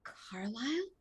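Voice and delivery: very soft, rising question; hushed, questioning call; tentative character voice. Acted. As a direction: ask the name softly, pitch lifting at the end. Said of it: "she just says".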